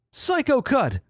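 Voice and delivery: high falsetto attack call; Jake voice. Acted. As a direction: yelp the move name. Ordering an attack.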